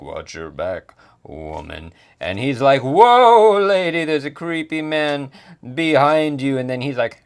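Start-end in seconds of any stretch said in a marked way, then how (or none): 0.0-1.9 low, soft warning from behind; lightly voiced menacing warning. 2.8-6.6 high, loud mock alarm; alarmed warning. Acted alarmed warning about creepy man.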